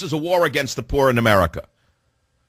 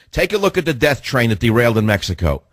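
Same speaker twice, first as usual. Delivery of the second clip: ordinary delivery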